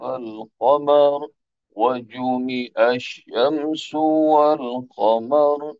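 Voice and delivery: slow melodic Quranic chanting; Quran recitation. Acted. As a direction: recite in a drawn-out chanted tune. Quran recitation before lesson.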